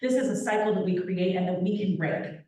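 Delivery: ordinary delivery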